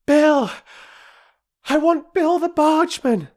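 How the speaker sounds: put-on a high, loud girl's cry, out of breath; girl shouting for help, breathless from running; breathless child's cry. A narrator's reading. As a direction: burst in calling out breathlessly for Bill. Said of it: "crying breathlessly".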